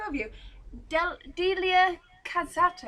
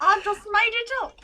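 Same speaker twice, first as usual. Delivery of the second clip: high, loud, through laughter; laughing admission; 8 semitones higher, 9 dB louder than usual. Real, not acted. Friend admits the tune was invented, laughing. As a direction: confess it while cracking up.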